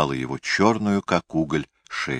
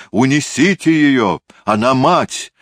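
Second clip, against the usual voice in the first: high, loud, distraught order; acted shout; 6 semitones higher, 5 dB louder than usual. A narrator's reading. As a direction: cry it out over the room. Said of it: "он обернулся и закричал".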